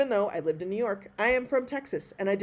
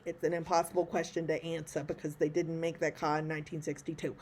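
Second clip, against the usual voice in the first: quieter, badly faked Brooklyn accent; terrible Brooklyn accent; 4 semitones lower, 7 dB quieter than usual. Quoting a film witness on a car question, bad Brooklyn accent. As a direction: put on a hammy Brooklyn movie accent. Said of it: "That's my terrible Brooklyn accent".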